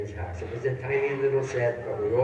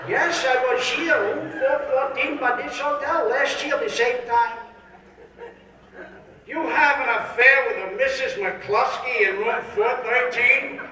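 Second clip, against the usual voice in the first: put-on slow high old man; 10 semitones higher, 4 dB louder, slower than usual. Hotel confrontation over affair.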